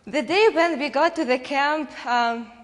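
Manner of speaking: ordinary talk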